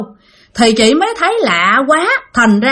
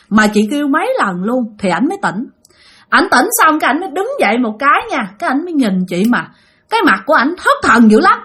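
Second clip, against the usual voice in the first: ordinary delivery, nothing marked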